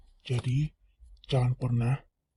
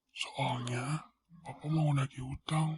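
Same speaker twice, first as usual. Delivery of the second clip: whisper; 6 dB quieter, slower than usual. Acted. Father whispering to daughter.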